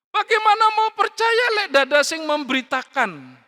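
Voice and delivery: shouted rhetorical question on a high pitch; forceful preaching. Acted. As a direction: hurl the challenge at the congregation. Preacher hammering a quoted scripture question.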